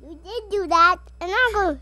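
loud insistence. Real, not acted. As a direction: insist loudly. Kids arguing.

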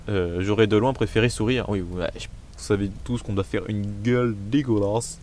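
ordinary delivery

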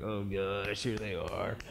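low, soft cringe; mock embarrassment. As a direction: shrink away under your breath. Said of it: "it's so embarrassing when they come out".

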